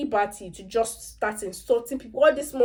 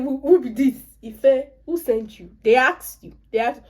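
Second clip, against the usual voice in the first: mock scolding; 4 semitones higher, 7 dB louder than usual. Acted scolding of meddling Ife.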